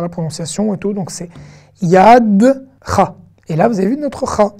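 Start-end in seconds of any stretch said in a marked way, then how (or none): 1.8-3.1 YADCHA split slowly as yad, then cha; silent e. Shown as a demonstration. Teacher showing the e stays mute, slow and split. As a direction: hold "Yadcha" in two pieces, no vowel after the d. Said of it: "c'est un e muet qui reste e muet".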